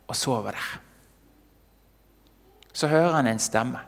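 usual speaking voice

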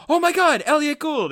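high-pitched retold gasp of recognition; starstruck exclamation. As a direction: act out spotting a celebrity. Acting own excited reaction to photo.

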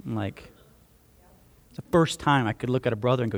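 usual speaking voice

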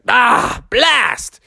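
high exasperated outburst; high-pitched cartoon exasperation; mock frustration. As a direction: curse in comic dismay. Froggy loses the game again.

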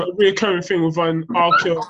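ordinary delivery

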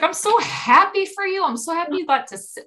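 high, gushing retold delight; happy for them. Fan congratulating two new friends, gushing happily. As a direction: gush it high and quick. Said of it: "Like, I really was".

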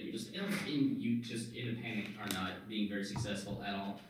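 ordinary talk, nothing marked

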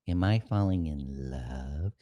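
quietly, slowly asking himself in awe; half-whispered mock realization; hushed wonder. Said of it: "you're like".

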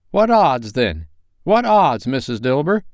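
put-on high, loud defiant old woman; cheeky old woman daring the others; bold charwoman. A narrator's reading. Charwoman voiced.

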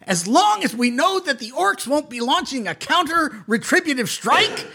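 high, anxious kobold king; Spazlatrash's voice. Acted. Spazlatrash asks the party about their mission, high and fretful. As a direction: pitch it up, fretful little ruler. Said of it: "DM voices Spazlatrash".

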